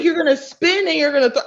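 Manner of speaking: giggling through the coach's line; laughing coach quote. Retold or imitated. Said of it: "he was like".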